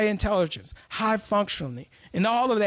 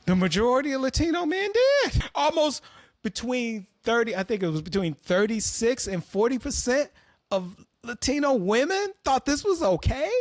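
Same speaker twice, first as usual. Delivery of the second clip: usual speaking voice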